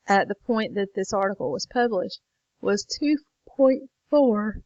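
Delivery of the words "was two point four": words pushed out through a yawn, rising and louder. Real, not acted. Late-night teacher reading a number to students, mid-yawn. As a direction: let a real yawn stretch the numbers. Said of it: "Sorry, I'm yawning again".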